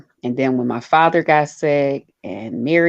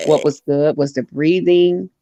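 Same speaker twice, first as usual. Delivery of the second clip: ordinary delivery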